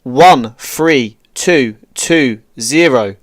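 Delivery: slow, clipped grid readout over radio; calm radio call for fire. Real, not acted. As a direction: read each digit slowly for the gunners.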